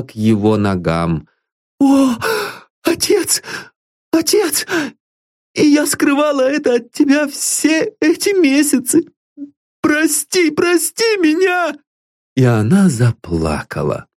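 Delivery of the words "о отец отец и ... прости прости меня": high, tearful pleading; a girl begging her father's forgiveness; pleading daughter. Acted. Daughter at her father's feet begs forgiveness, tearful.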